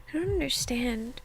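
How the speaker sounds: hushed whisper narrating the scene; whispered reading. Acted. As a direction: breathe the story out in a whisper.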